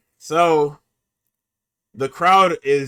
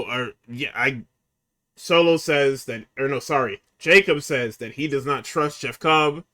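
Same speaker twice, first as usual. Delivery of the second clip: ordinary talk, nothing marked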